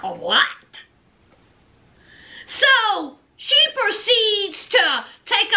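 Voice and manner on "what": deep, drawn-out what; baffled, low and slow; mock disbelief; low puzzled what. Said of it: "It's like".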